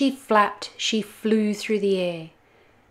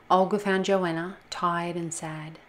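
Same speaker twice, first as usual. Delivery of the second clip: usual speaking voice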